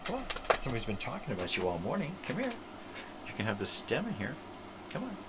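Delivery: high sing-song coaxing, fast in places; coaxing the dog. Real, not acted. Calling the dog Oliver over in a high sing-song.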